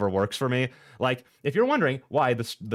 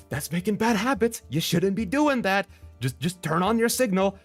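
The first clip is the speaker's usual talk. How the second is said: scolding; 8 semitones higher than usual. Retold or imitated. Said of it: "I'm like".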